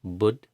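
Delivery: "Bud" with a hard unaspirated b and a stopped d; clipped Bud. Shown as a demonstration. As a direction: cut "Bud" off at the d. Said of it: "the first syllable is B U D".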